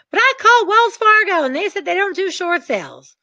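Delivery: high, protesting newbie; whiny complaint. Retold or imitated. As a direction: whine it like a let-down rookie. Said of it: "you call me and go".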